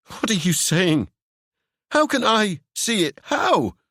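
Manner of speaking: high, desperate questions; desperate Seb. Acted. Seb pleading with Ewan, high and desperate. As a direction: plead to understand.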